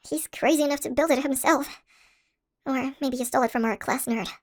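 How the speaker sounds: usual speaking voice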